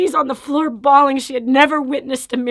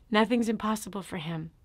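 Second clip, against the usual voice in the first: ordinary talk, nothing marked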